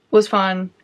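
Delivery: ordinary delivery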